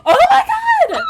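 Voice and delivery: shrieking oh my god in fright; jump-scared, high shriek; startled scream. Real, not acted. Sudden scare in game.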